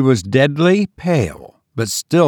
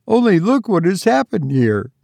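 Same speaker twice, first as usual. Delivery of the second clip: put-on frightened girl; 8 semitones higher than usual. Frightened daughter.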